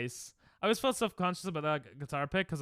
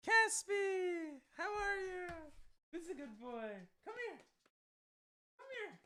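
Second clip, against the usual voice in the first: talking to a dog; 13 semitones higher, 7 dB quieter than usual. Real, not acted. Baby-talking to his dog.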